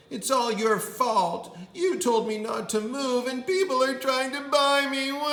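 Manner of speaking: high, loud whiny blame ending in a wail; hammed-up crying kid; wailing child. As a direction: sob it out to daddy. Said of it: "Tia said".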